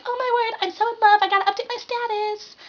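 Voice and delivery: hammed-up lovestruck gushing; mock giddy squeal. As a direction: squeal it like an overexcited teen. Facebook love post voice.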